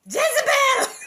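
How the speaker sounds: shrieked accusation, high and loud; hammed-up crazy lady shriek. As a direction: scream it like a fire-and-brimstone zealot. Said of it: "the crazy lady is like".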